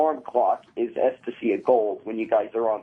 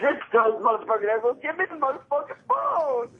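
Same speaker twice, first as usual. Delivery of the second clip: much higher yelled demand; shouted demand; 13 semitones higher than usual. Real, not acted. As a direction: yell it into the living room. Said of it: "I dare you to take your towel off".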